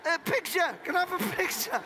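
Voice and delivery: high-pitched eager fan asking for a photo; pestering fan. Retold or imitated. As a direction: pipe up, pestering for a picture. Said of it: "He leaned over and went".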